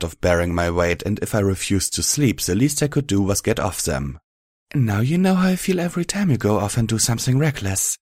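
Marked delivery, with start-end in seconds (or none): 4.7-8.0 lifted gentle voice, no bite; a gently teasing mare; Velvet without malice. A narrator's reading. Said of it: "replied without malice".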